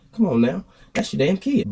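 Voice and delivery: high-pitched, exasperated appeal; disapproving protest. Retold or imitated. Onlooker silently appealing to the dragging mother, exasperated. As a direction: plead upward in disbelief. Acting out his reaction to mother.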